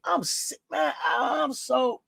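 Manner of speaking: ordinary talk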